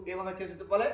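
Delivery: normal talking voice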